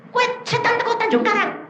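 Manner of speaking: high-pitched disbelieving question; acted-out incredulity; incredulous questioning. Acts out questioning the spirit.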